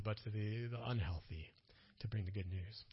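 softly, almost to yourself; hushed aside; whisper. Real, not acted.

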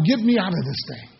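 ordinary talk, nothing marked

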